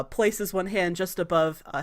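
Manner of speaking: ordinary delivery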